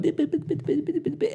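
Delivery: high beeping noises; computer beeps. Retold or imitated. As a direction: beep it high and staccato. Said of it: "looking at Robin going".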